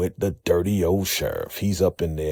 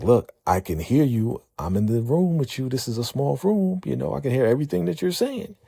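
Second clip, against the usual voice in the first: rising, fast, exasperated protest; exasperated captive; 8 semitones higher than usual. Retold or imitated. Captive complaining to her whispering captors, fast and rising. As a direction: talk them down, getting higher and faster.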